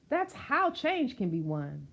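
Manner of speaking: plain reading voice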